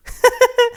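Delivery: short, high-pitched giggle; acted laugh. A narrator's reading. Alex hidden in the bushes, laughing at Steve. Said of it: "почув знайомий сміх".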